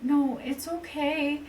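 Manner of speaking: slow, high-pitched placating voice; imitated soothing. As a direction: mimic a teddy-bear friend calming someone down. Friend soothing her in retold scene.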